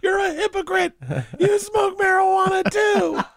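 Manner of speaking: very high, drawn-out whiny squeal; hammed-up childish protest, breaking into laughter; mock teenage tantrum. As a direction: parody a wailing kid accusing a parent. Mimics the kid's tantrum as teenager.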